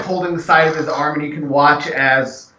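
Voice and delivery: usual speaking voice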